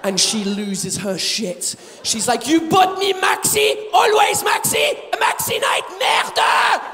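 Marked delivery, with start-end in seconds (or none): 2.5-6.8 furious wife shout. Retold or imitated. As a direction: scream at your husband for buying the wrong pads. Wife loses her shit at him.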